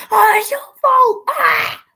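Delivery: high, loud hammed-up cry of blame; queen wailing in court, hammed-up shriek; mock wailing accusation. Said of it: "Queen Ramonda is basically in court saying".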